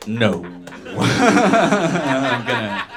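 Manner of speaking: ordinary talk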